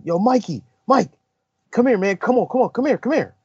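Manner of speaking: calling out high across the fairway; mock yelling. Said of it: "And Phil starts yelling".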